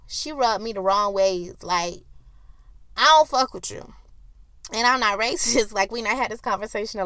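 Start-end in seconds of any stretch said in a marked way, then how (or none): 3.0-3.9 a cold, blunt brush-off, a touch louder; dismissive. Retold or imitated. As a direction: shut her out with attitude. Tells off older coworker.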